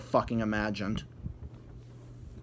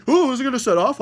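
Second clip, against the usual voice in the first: higher, louder hammed-up worried question; mock anxious prisoner; 9 semitones higher, 6 dB louder, faster than usual. Imagined prisoner fearing the bomb, hammed-up anxious question. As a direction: fake the prisoner's jittery fear.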